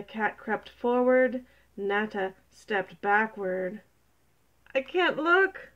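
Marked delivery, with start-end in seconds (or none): 4.7-5.7 high, louder anxious cry; alarmed dread. Acted. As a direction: cry out, unable to watch. Spider dreads watching the cat attack.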